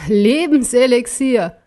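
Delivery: langsam, spöttisch und höhnisch. A narrator's reading. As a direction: das Wort verächtlich ausspucken. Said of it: "brach in ein höhnisches Gelächter aus". (höhnischer Spott über das Lebenselixier)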